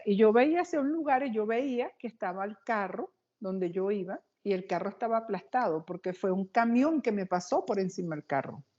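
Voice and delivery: ordinary talk, nothing marked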